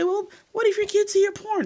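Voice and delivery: high mocking voice imitating nosy people; mocking quote. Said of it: "People say".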